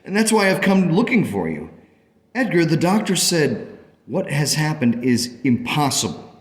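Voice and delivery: high-pitched urgent astonishment; elated, insistent. Acted. Parent telling Edgar the recovery is impossible, excited and insistent. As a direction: press the point with mounting amazement.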